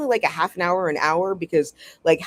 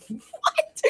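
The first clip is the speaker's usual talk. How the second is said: "What?" squeaked very high through a laugh, rising; a laughing, falsetto "What?" from the former Speaker. Retold or imitated. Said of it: "John Burkow is definitely somewhere being like".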